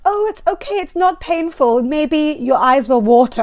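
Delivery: high, sing-song reassuring fake nurse; breezy lie that it won't hurt; chirpy voice of the lying nurse; mocking the nurse. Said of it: "She was like".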